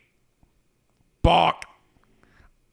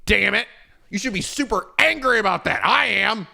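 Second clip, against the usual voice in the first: normal talking voice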